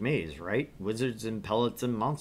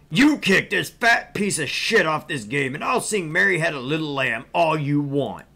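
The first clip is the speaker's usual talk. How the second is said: angry yelling; 5 semitones higher, 8 dB louder than usual. Acted. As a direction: roar at the attendant. Angry customer yells, hurts voice.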